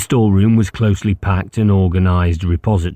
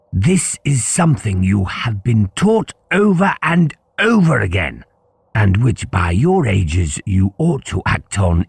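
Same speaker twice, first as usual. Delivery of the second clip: stern headmaster; 6 semitones higher than usual. Acted. Headmaster lectures the two boys.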